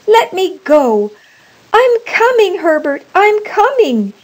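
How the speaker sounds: high, loud calling out; desperate cry. A narrator's reading. Old woman struggling free to reach her son, desperate cry. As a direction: call out urgently, pitch soaring. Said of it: "she cried, struggling".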